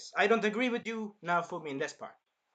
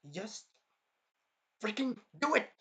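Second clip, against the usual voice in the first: eager urging; 4 semitones higher than usual. Real, not acted. Urging characters to kiss.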